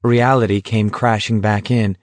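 ordinary delivery, nothing marked

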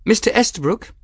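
put-on high, clear young woman's address; Bridget, formal. A narrator's reading. Young woman to the master of arms, formal.

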